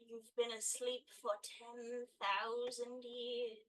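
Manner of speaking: soft, drawn-out words, each stretched; slow proclamation. Acted. Frog up against the glass telling the man, slow proclamation. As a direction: draw it out slowly and quietly, like an omen. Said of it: "as close to the glass as I can and say".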